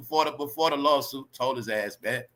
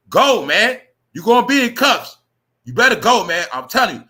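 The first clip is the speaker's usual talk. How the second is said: louder, urgent warning shout; shouting at him; 5 semitones higher, 9 dB louder than usual. Real, not acted. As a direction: yell it like a real warning. Yelling at Shannon to leave.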